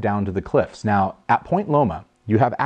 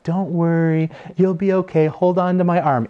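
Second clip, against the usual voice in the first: higher, gentle reassuring voice; 8 semitones higher than usual. Retold or imitated. Husband reassuring his wife on the steep hill, gently. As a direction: soothe her down the hill.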